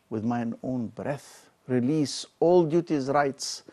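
declaimed Shakespeare, rising and falling with feeling; dramatic verse reading. Acted. Lecturer to students, reading King Richard's lament with dramatic weight. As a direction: give the speech its full tragic weight. Reads the abdication speech.

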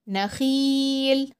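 نخيل with a stretched ending; long ii in نخيل. Shown as a demonstration. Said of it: "ونطول الصوت قوي".

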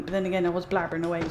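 usual speaking voice

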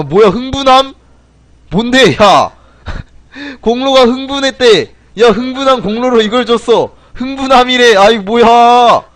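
loud, high-pitched real disbelief with laughter; incredulous outburst. Real, not acted. Streamer reacts to absurd award name, loudly incredulous.

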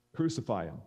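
ordinary delivery

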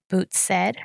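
ordinary delivery, nothing marked

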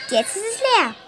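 ordinary talk

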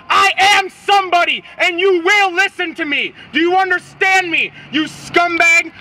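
forceful, emphatic outraged tirade; real indignant shout; angry shouting. Real, not acted. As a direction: hammer each demand at the person dodging you. Climax of angry public confrontation.

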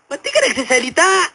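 high, demanding mock questioning; interrogating healer. Healer questions the possessed medium, mock stern demand. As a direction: demand an answer from the spirit.

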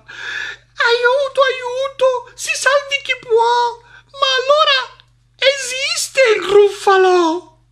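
very high, loud cry for help; panicked shriek. Acted. Mouse discovers the monster exists, panicked shriek. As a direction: shriek in full-blown panic.

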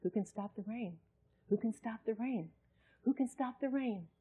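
soft, hushed repeated question; quiet inner voice. Retold or imitated. Preacher recalling a quiet inner voice. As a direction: voice the quiet question God whispers. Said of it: "this voice says to me".

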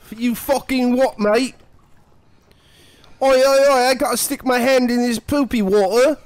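Cockney James Sunderland. Acted. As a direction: play an outraged Cockney, badly. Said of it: "more Cockney James Sunderland".

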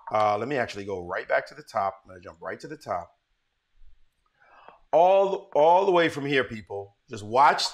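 ordinary talk

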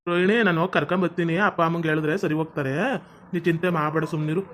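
low, soft consoling voice; gentle reassurance. Acted. Husband consoles his crying wife. (ಮೆಲ್ಲಗೆ ಸಮಾಧಾನ ಮಾಡುವ ಧ್ವನಿ)

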